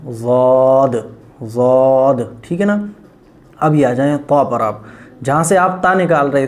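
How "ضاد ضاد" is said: ضاد heavy from the side of the tongue; correct zuaad. Shown as a demonstration. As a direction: thicken ضاد at the side teeth. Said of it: "تو یہاں سے نکلے گا". (ضاد موٹا ادا کریں)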